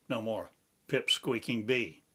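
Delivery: ordinary talk, nothing marked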